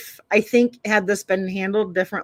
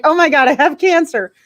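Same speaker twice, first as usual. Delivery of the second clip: high, loud mock panic; hysterical doctor; 7 semitones higher, 6 dB louder than usual. Doctor finding a lump, retold in mock panic. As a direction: imitate a hypochondriac's alarmed outburst. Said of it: "she's hysterical".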